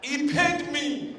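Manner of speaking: loud high laughing delivery. Real, not acted. Comic telling the audience the punchline, laughing loud and high. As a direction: burst out laughing through it.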